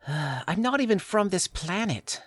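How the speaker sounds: high, slow alien voice; alien girl voice. Pretend. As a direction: play the alien girl from another planet. Voices Eve in the roleplay.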